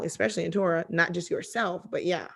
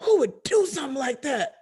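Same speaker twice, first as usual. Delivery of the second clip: indignant disbelief; 6 semitones higher than usual. Retold or imitated. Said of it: "he was like".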